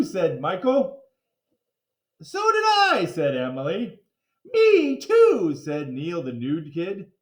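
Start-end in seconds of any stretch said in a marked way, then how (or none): 2.2-3.1 eager chime-in. A narrator's reading. 4.5-5.5 eager chime-in. A narrator's reading.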